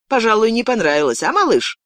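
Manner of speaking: high, mocking taunt. Girl to her silent captive after a blow, taunting. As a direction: goad him, pitch jumping up at the end.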